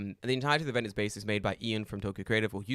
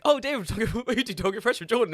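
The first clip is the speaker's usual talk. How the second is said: high, loud hammed-up recognition; mock fan gushing, fast at the end; imitating excited fans; 11 semitones higher, 7 dB louder than usual. People recognising David at the event, mock excited. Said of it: "A lot of people were like".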